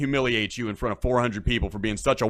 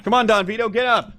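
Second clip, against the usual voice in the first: mock cheer; 10 semitones higher, 3 dB louder than usual. Real, not acted. Cheering on fallen grandpa on stream.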